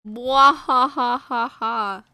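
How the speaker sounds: loud, slow villain cackle; drawn-out pretend villain laugh; mock evil laugh. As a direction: gloat with a hammed-up mwahaha. Gloating that viewers are stuck.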